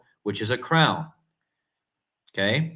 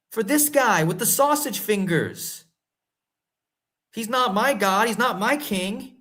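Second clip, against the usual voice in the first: indignant rant; 6 semitones higher than usual. Real, not acted. Ranting about the king and pandemic.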